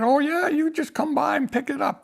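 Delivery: imitating Jim. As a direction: let each phrase rise up high. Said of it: "that's the way he talked".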